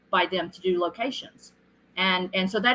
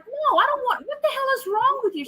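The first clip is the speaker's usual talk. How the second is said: exasperated. Retold or imitated. Retold exasperated reply to roommate.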